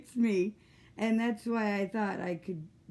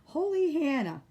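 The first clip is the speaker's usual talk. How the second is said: surprised exclamation; 7 semitones higher, 4 dB louder than usual. Real, not acted. Reacting to manure fire.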